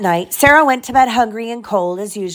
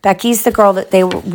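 ordinary delivery, nothing marked

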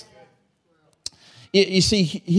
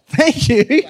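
delighted thanks; 10 semitones higher, 5 dB louder than usual. Real, not acted. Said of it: "oh, thank you".

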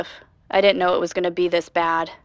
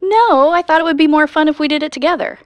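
excited; 7 semitones higher, 6 dB louder than usual. Acted. Eager reply, loud and high.

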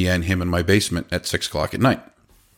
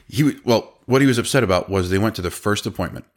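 ordinary delivery

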